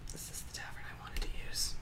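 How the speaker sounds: barely audible whisper; hushed, whispered aside; muttering to self. Real, not acted. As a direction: whisper it absently to yourself. Game master muttering while searching audio.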